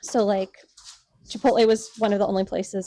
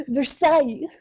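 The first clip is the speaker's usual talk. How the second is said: mock French Versailles; a playful French accent; 3 semitones higher, 5 dB louder than usual. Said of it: "say these in your best French accent".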